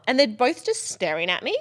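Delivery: normal talking voice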